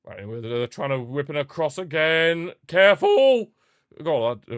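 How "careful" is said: higher, louder yelp that cracks. Real, not acted. Streamer warns his defender, voice cracking loudly. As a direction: voice cracks on a sudden warning shout. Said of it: "sorry for the voice crack".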